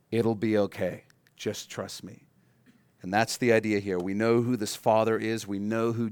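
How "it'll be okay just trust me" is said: a hint of a soft, low, soothing dad; calm the kid down gently; reassuring parent.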